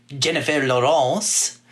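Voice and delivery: put-on name said in a playful French accent; "Jennifer Lawrence" with a mock French accent. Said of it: "in a French accent".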